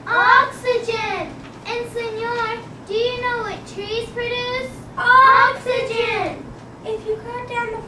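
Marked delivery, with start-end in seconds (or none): 0.1-1.3 loud, slow, high exclaim. Acted. Porcupines exclaim answer. 5.0-6.4 loud, slow, high exclaim. Acted. Porcupines exclaim answer.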